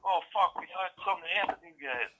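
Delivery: high, relieved panicked voice, imitated; retold panic. Friends retold telling the uncle they feared the worst, high and panicky. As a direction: replay the fright of thinking they'd crashed. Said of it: "We're like".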